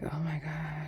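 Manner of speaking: hushed, whispery mock disapproval; imitated scandalized aside, under the breath; mock disapproving older woman. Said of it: "the older woman will be like".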